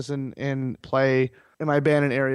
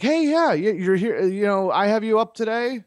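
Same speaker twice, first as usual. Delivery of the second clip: bright high cheerful hello, much higher; excited greeting; 6 semitones higher than usual. Retold or imitated. Court lawyer spots her client, excited higher greeting. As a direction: greet him like a happy surprise.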